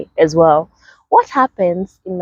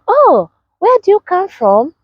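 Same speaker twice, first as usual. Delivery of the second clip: put-on chirpy girl's question; 11 semitones higher than usual. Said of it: "she went like".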